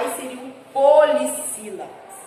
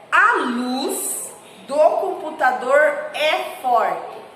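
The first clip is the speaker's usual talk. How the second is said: slow read-aloud; much slower than usual. Shown as a demonstration. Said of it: "vamos ler a frase da questão dois juntos".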